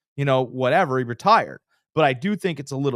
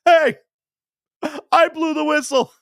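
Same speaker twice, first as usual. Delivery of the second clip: much higher and louder, a referee's exasperated cry; mock referee protest; 7 dB louder than usual. Host imitates helpless referee whistling in vain, high mock yell. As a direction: yelp it like the ignored referee. Poor referee voiced in story.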